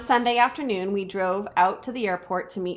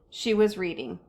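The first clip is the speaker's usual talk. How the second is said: ordinary delivery, nothing marked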